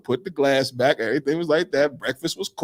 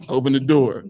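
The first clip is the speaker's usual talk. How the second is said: ordinary delivery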